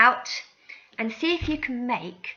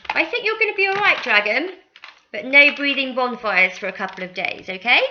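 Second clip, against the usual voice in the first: high, fast and louder doctor; cheery doctor voice; 5 semitones higher, 7 dB louder than usual. Pretend. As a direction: reassure the dragon brightly. Doctor reassures dragon.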